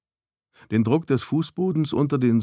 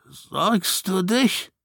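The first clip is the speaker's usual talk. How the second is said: slow, drawn-out question, pitched higher; dull; 8 semitones higher, much slower than usual. A narrator's reading. Snow answering the worried visitor, dully. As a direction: stretch it out, dazed and dull. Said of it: "sagte er dumpf".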